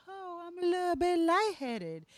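high, woozy mock-dizzy voice; drugged victim. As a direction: act out feeling faint. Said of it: "and you're like".

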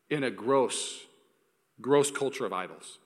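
ordinary talk, nothing marked

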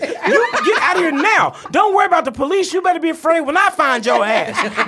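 high, loud furious mother; furious mom yelling. Retold or imitated. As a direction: yell like an enraged mom. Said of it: "loud bang on the door".

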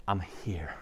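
a hushed, humble whisper to God; whispered prayer. Pretend. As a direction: whisper I'm here softly. Quiet prayer to the Father.

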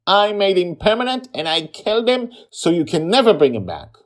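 put-on high, loud insistent girl; defiant shout. A narrator's reading. Girl defiantly admitting the killing.

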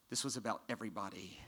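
soft, hushed whisper. Real, not acted. Preacher whispering the closing line to the congregation. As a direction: let it fall to a whisper.

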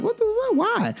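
high-pitched, sputtering bafflement; mock alarm. Patient trying not to worry about an MRI, high-pitched alarm. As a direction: squeak out the question in mock panic. Worried reaction to suggested MRI.

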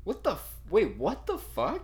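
high, slow "what the fuck" in real shock; stunned disbelief. Real, not acted. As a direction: let the disbelief stretch it out. Learns the movie's age.

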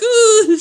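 high, loud crying whimper, half laughing; mock sob. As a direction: imitate his own teary sob while laughing. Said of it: "'Cause I'm sitting here like".

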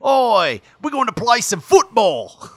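loud, high mock Australian accent. Shown as a demonstration. Trying to get on the radio, hammed-up Australian accent. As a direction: shout it in a put-on Australian accent. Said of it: "if I talk like an Australian".

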